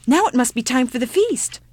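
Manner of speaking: high, loud anticipation; eager child. Acted. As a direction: announce it with childish excitement. Child eagerly expects a feast.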